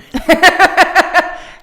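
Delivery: high, loud laughing burst; can't hold it in. Real, not acted. Friend laughing at her drugged words, loud high laugh. Recalling the Pound Town line.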